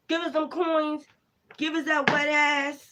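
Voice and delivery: high, taunting spirit demand; hostile spirit demanding. Retold or imitated. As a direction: sneer it out as the greedy spirit. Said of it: "Shut the fuck up".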